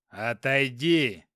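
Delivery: slow growled order. Acted. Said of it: "прорычал Хопкин". (прорычать медленно)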